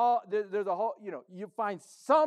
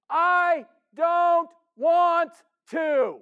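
slow, loud, drawn-out I don't want to; hammed-up pouting refusal, louder; stubborn refusal; 7 semitones higher, 9 dB louder, much slower than usual. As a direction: dig in like a sulking child, slow and loud. Pastor voicing stubborn sinner.

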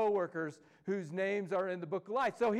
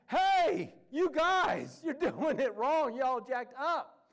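high, loud, laughing call-out, slowing at the end; playful hammed-up rebuke; mock scolding; 7 semitones higher, 5 dB louder, much slower than usual. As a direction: scold the church like a fed-up apostle with a grin. Letters scolding churches, mock voice.

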